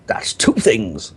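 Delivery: put-on slow Sunderland accent from an advert. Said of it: "the only two things I can do in a Sunderland accent".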